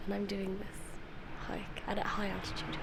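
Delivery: slow soft whisper; hushed aside to camera. Real, not acted. Sick, commenting on camera.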